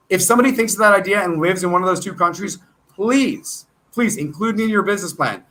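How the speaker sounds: ordinary talk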